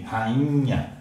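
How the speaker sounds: "rainha" with the I nasal, as if an N followed it; nasalized I. Shown as a demonstration. Teacher to class, showing the nasal I in rainha. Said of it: "Esse I fica anasalado".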